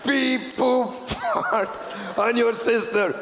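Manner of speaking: slow mock shout; silly prank shout. Prank texter taunting the leader of Iran, slow silly shout. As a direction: yell the childish insult, drawn out.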